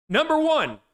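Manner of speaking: big high announcer call of the number; loud announcer call. Acted. Announcing the list to the stream, loud and high. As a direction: call it out like a countdown host.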